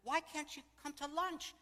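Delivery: high, loud, drawn-out question; indignant demand. Retold or imitated. Golden girl challenging June over Monday lunch, indignant. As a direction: imitate a pushy friend. Friend demands to know why.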